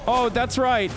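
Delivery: high-pitched, gloating fake sympathy; mocking apology. Gamer gloating to a murdered enemy, mocking apology.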